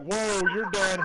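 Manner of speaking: a slow, loud "whoa, you're done" as a stern father; mock stern dad. Dad cutting off his son's game, slow and loud. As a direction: drag it out like a dad laying down the law.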